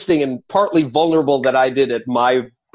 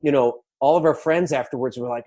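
ordinary talk

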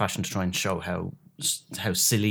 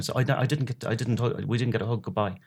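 ordinary talk, nothing marked

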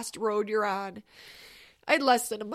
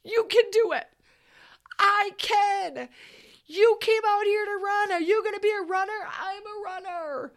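pumped-up yelling; 9 semitones higher, 4 dB louder than usual. Retold or imitated. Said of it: "had me screaming and yelling".

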